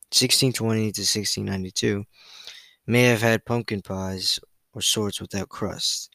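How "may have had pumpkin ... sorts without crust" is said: ordinary talk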